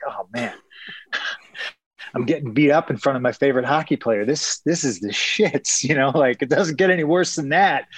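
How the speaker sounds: laughing dismay. Retold or imitated. Fighter recalling being beaten before his hockey hero, laughing dismay. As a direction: relive it, laughing at your own bad luck.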